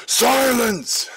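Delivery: high, piercing shouted command; loud yell. A narrator's reading. Villain yells at Lulu from behind, loud and high. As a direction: bellow it like a battle cry. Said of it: "a loud yell of".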